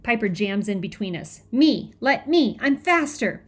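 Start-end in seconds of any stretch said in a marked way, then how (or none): 1.5-3.4 high, loud demands; urgent, raised-voice volunteering; insistent shouting. A narrator's reading. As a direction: push in and demand the job. Girl jams in, demanding the baby.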